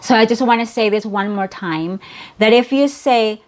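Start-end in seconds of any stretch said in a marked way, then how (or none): none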